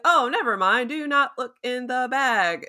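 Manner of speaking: high, emphatic mock backtracking; imitating flustered Steven. Steven stopping Layla looking in the bag, mock flustered.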